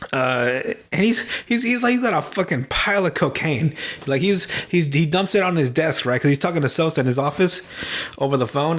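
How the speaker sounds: ordinary talk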